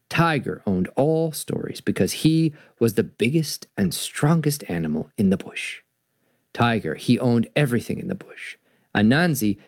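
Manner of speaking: lively read-aloud storyteller voice; animated picture-book narration; story narration. A narrator's reading. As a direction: tell it like reading to kids at bedtime. Reads the children's story aloud.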